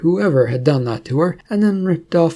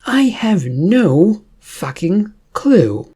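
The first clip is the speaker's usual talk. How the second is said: slow, higher and louder furious line; angry retort; 6 semitones higher, 4 dB louder, much slower than usual. Acted. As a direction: drag each word out in open anger. Princess glares, swears at Cordite.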